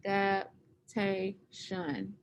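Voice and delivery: Adaptation drawn out very slowly, syllable by syllable; slow syllable count. Shown as a demonstration. Teacher slowly sounds out Adaptation for the class to count syllables. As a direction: stretch out Adaptation so each syllable can be counted. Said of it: "how many syllables does adaptation have".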